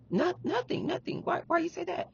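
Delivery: small, high, hushed voice playing innocent; quiet, high-pitched imitation of a partner dodging; imitated evasive denial. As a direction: brush it off softly and high.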